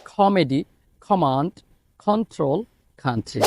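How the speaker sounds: each K said like Bangla খ, as in ক্যাম্প and কমান্ড; aspirated K words. Shown as a demonstration. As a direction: puff the air out on every K, word by word. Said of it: "তাহলে খেয়াল করুন".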